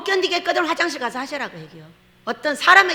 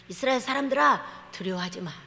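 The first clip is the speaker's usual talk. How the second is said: quiet, tender reassurance, fading softer; soft and gentle; 3 semitones lower, 7 dB quieter than usual. Acted. Preacher voicing God gently calming Israel, soft. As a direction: hush them like a comforting father. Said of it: "그럴 때는".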